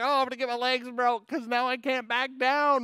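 put-on high, frantic frightened kid voice; panicked kid. Boy fearing the bully will break his legs, panicked and high. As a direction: blurt it out scared and cornered. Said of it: "I was like".

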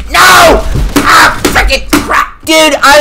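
high loud cry of frustration; anguished yell. Real, not acted. Gamer losing his forty-kill chance, anguished yell. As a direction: wail at losing the streak.

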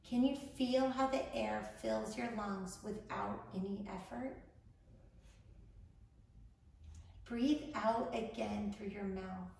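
unhurried instructions, leaving room to breathe; guided meditation. Acted. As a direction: guide a panicked listener calmly through each breath.

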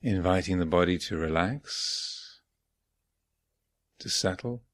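unhurried phrases with long silences between; guided meditation. Real, not acted. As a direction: guide them gently inward, leaving space after each phrase. Leads guided morning meditation.

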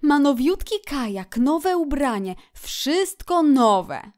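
high, loud list, stretched at the end; Otter, emphatic gossip. A narrator's reading. Otter lists Toad's new things, emphatic. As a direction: build the list to a long finish.

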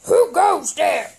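heroic frog voice. Acted. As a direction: boom out an old-fashioned warrior's challenge. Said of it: "And the frog says".

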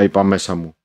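plain reading voice

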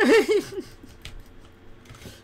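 high loud cackling laugh; burst of laughter. Real, not acted. Gamer laughing at his own shout, loud high laugh. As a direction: crack up right after the yell.

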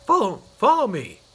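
eager call. Acted. Mister Happy urges him on.

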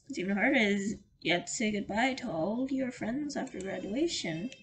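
ordinary talk, nothing marked

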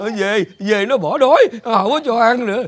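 broken, sobbing complaint; unstoppable crying. Acted. Father refuses to go home hungry, crying. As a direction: keep sobbing as you protest. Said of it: "ông khóc nín không dứt luôn".

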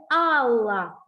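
Алла with both vowels drawn out; slow, sounded-out Алла for a pupil; stretched word. Shown as a demonstration. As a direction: stretch each А in Алла so it stands out. Teacher stretches word for listening.

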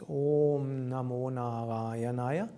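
Om Namo Narayanaya with a long, held O in Om. Shown as a demonstration. Teacher correcting pronunciation, long O in Om Namo Narayanaya. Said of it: "O is long".

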